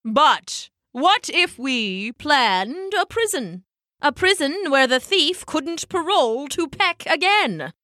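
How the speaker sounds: slow, dramatic elder penguin pitching an idea; Poppo. A narrator's reading. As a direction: unveil it with stretched words and swoops. Poppo unveils his prison idea.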